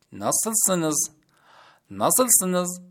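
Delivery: ناسلسنز said clearly as a model; Turkish word demo. Shown as a demonstration. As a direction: repeat ناسلسنز for learners. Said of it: "حالتون چطوره؟".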